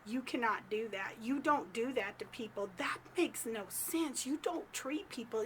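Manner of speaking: a hint of a mom correcting a child; scolding mom. Narrator takes Gary aside, quietly scolding like a mom. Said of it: "feeling like I'm his mom here".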